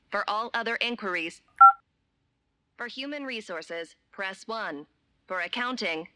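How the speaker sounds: automated recorded menu voice; robotic hotline recording, evenly paced; phone menu voice. Acted.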